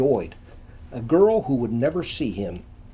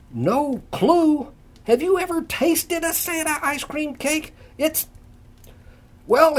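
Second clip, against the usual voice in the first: excited; 9 semitones higher than usual. A narrator's reading. Ray's enthusiastic pitch.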